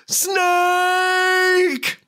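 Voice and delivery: one very long, high held word; drawn-out mock cry. Host reading a listener's pick, hammed-up drawn-out cry. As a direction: stretch the name out like a dramatic call. Reads out a famous character name.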